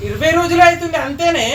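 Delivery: exasperated, high-pitched objection; quarrelling protest. Real, not acted. Husband protests during quarrel.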